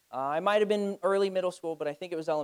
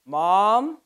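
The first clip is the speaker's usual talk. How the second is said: loud worried call across the crowd; 8 dB louder than usual. Retold or imitated. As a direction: call for mom as a lost child. Child calling for lost mom.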